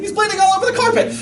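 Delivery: high, fast frantic outburst; imitated panic. Wife frets over bleeding son, imitated high frantic cry. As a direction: rush it out like a frantic mother.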